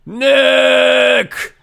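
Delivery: high, loud shout of the name; shouting his name. Acted. Nick shouts at the sound-triggered camera. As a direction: yell it at the mic. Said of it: "Maybe I'm not saying it loud enough".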